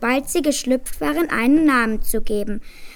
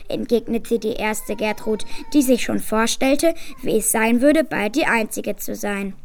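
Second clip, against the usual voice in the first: ordinary delivery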